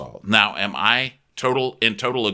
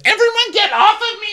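very high, loud shriek; mock scream; 7 dB louder, faster than usual. Podcaster mocks Lindsay screaming at the girls, high shriek. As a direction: shriek at everyone to back off.

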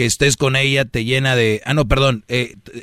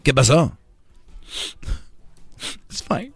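put-on passive-aggressive girlfriend; 2 semitones higher than usual.

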